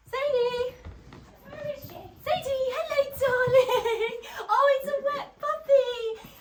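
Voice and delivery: high sing-song puppy talk. Real, not acted. Greeting the dog in baby talk.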